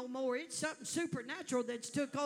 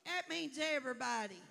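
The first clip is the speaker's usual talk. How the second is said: ordinary delivery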